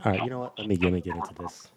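ordinary talk, nothing marked

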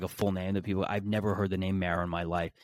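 ordinary delivery

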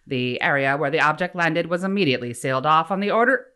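put-on old newsreel mid-Atlantic accent, loud; fast, exaggerated 1940s announcer accent. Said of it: "you're trying to do, like, a mid-Atlantic accent?".

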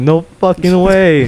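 high, drawn-out yell of disbelief; retold excited scream. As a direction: stretch it out high, re-enacting your amazement. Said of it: "And I'm screaming".

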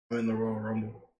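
low, quiet mumbled line; murmur. Retold or imitated. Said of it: "he murmurs".

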